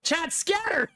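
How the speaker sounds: hammed-up squeak of alarm; mock alarm. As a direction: sound the retreat, jokingly. Joking flight from awkward moment.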